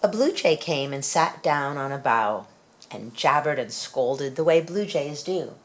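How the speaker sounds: plain reading voice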